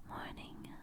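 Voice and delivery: normal talking voice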